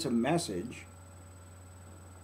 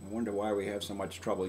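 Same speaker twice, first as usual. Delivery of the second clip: usual speaking voice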